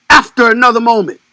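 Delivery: usual speaking voice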